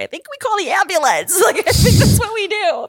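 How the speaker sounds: high, laughing half-yell; giggling while hurt; laughing panic. Retold or imitated. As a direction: laugh it out in disbelief. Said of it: "I was like".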